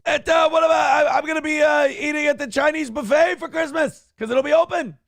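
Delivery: loud, high-pitched sports radio rant; Chris Russo impression. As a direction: yell it like a ranting radio host. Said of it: "from just doing a Chris Russo impression".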